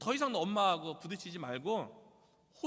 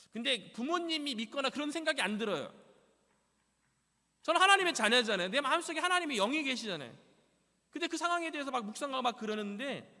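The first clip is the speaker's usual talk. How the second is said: raised, fervent testimony voice; preaching; 5 semitones higher, 4 dB louder than usual. Acted. Believer testifying to congregation, fervent preaching. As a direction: lift it up like a sermon.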